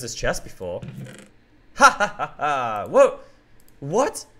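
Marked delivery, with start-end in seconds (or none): 1.8-2.9 evil laugh. Acted. Villain laughs unseen.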